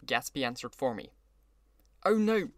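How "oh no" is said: high, loud exclamation; exclaiming. A narrator's reading. Gatsby corrects Wolfsheim's mistake, exclaiming. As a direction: burst in with a quick protest. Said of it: "he exclaimed".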